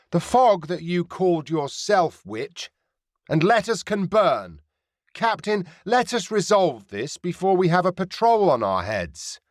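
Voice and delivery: pirate captain warns the privateer in a scornful voice; woman pirate captain. Acted. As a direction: sneer at the witch then press the captain.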